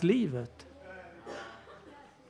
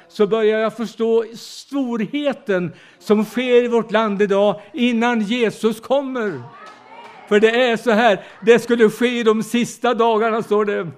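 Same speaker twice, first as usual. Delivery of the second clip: preaching; 3 semitones higher, 4 dB louder than usual. Acted. Sermon climax on last days.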